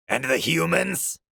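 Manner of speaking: high, loud officer voice; commanding officer. A narrator's reading. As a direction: challenge them as commander. Commander addressing the humans.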